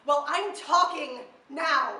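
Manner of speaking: loud, drawn-out, defiant declaration; forceful retort. Acted. Poet answers those who said to talk, loud defiant retort. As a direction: hit each word hard and slow.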